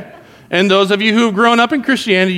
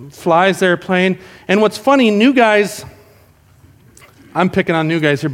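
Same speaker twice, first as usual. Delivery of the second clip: normal talking voice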